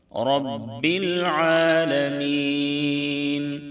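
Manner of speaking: slow, high, melodic tajweed chant; slow melodic Quran recitation. Shown as a demonstration. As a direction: draw each vowel out in a sung recitation.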